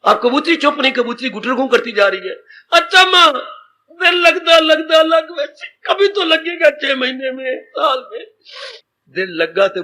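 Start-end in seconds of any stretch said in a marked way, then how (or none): 2.7-8.2 tearful farewell. Retold or imitated. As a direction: say goodbye to mother while weeping. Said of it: "लड़की बड़ी रोई".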